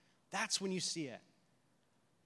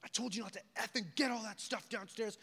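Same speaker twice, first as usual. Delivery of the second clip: higher-pitched mimicked rant; retold angry outburst; 4 semitones higher than usual. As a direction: imitate a fed-up cousin losing it, without real volume. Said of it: "all of a sudden just gets so".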